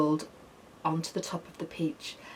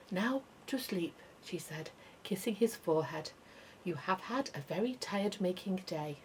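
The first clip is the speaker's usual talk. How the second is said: much softer, tender voice; tender lullaby tone; 8 dB quieter than usual. A narrator's reading. Spider tucking James into bed, soft tender. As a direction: soothe him to sleep.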